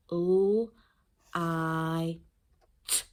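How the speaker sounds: l, I, t said as isolated phonemes; sounding out. Shown as a demonstration. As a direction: say "l, I, t" as three separate sounds with gaps. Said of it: "hear the separate sounds there".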